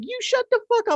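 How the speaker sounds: very high squealing retort; mock screaming back. Angry women yelling back, very high mock screech. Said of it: "And they were like".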